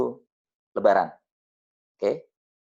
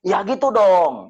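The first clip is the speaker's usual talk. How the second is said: high, slow, louder approval in her voice; drawn-out high "that's more like it"; widow agreeing; 8 semitones higher, 7 dB louder, much slower than usual. Retold or imitated. Widow approving the speaker's prayer, slow high approval. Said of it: "dia bilang gitu".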